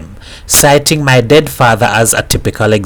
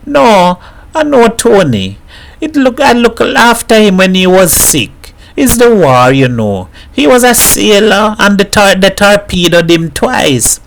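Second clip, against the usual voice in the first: higher, earnest woman defending her man; lightly voiced woman pleading for her husband; pleading Mrs Herrera; 8 semitones higher than usual. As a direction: insist he is good, gently pleading.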